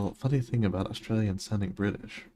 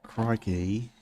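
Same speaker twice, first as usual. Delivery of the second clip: mock Australian.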